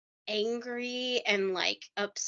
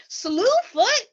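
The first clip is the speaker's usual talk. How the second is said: "Slewfoot?" squeaked much higher and louder in mock amazement; mocking disbelief; 13 semitones higher, 7 dB louder than usual. Hosts mock the strange name with a high, loud incredulous squeak. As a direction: toss "Slewfoot?" up high like you can't believe he said it. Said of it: "We were like".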